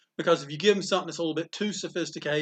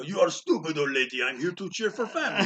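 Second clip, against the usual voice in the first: heavy mock Russian accent, laughing; playful fake Russian accent taunting the crowd; Ivan Koloff Russian accent; 4 semitones higher, 5 dB louder than usual. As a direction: ham up a Russian wrestler heel in a Russian accent. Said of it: "I would talk like Ivan Koloff and go".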